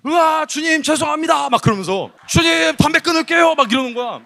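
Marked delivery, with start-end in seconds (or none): 0.1-1.5 high, wailing imitation of prayer; mock repentant cry. Youth preacher mimics an older boy repenting at the retreat, high wail. 2.3-3.6 high shouted vow in prayer; mock repentant cry. Youth preacher mimics a smoker vowing to God, high mock shout.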